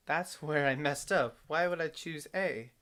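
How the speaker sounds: frustrated at own mistake; loud, annoyed self-scolding; exasperated. Real, not acted.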